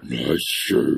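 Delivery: very low, quiet deep voice; deep creepy voice. Shown as a demonstration. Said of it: "just say normal things like".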